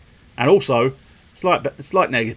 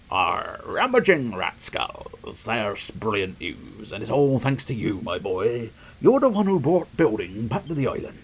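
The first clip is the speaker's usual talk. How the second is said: put-on jolly old leader; 4 dB quieter than usual. Voices a delighted village elder.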